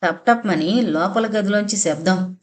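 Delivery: ordinary delivery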